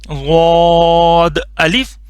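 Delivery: صاد said thick and heavy, slowly drawn out; heavy zaad. Shown as a demonstration. Teacher showing students the heavy zaad, slow. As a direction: give the dotted صاد a full heavy sound. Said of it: "موٹا کر کے پڑھنا ہے لازمی".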